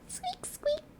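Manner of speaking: breathy tiny squeaks, very high; whispered mouse squeaks. Shown as a demonstration. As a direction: squeak like a little mouse under your breath. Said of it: "that hurts my throat".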